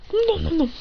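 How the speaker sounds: high, playful "nom nom" munching; munching noises. Acted. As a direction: mimic eager chewing. Imitating a character eating.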